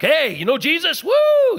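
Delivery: high, loud whooping excitement; self-mocking, overexcited shout; overeager evangelist. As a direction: burst out in a hammed-up holler, ending on a woo. Mocks own overeager evangelism.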